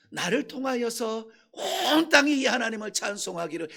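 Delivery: ordinary talk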